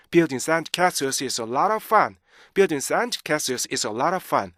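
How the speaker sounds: connected sentence on short breath, LOT OF run together; linked reading. Shown as a demonstration. Teacher modelling for students, smoothly linked sentence. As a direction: join every chunk into one flowing line. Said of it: "이렇게 여러분들 읽어주셔야 돼요".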